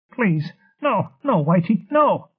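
very high, desperate begging; panicked pleading. A narrator's reading. Ryle begs Whitey to stop, panicked pleading.

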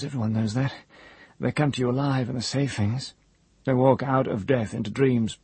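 high, rambling, half-mad voice; a broken man babbling secrets; crazed old wizard. A narrator's reading. Hare rambles about death.

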